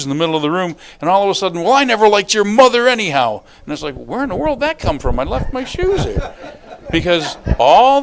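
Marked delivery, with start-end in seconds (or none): 1.6-3.4 acted outburst, higher and louder; mock marital blow-up; exploding in anger. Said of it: "you're just gonna explode". 3.9-6.3 much higher, quick bewildered retort; mock disbelief; baffled protest. Acts baffled husband after outburst.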